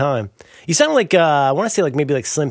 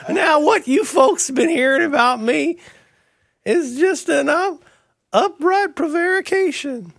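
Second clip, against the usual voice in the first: very high, slow rural drawl; Slim Pickens; 12 semitones higher, slower than usual. Pretend. Film cowboy denying rumours, high rural drawl. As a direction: protest like a slow-talking, high-pitched cowboy. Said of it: "Did you know that Slim Pickens".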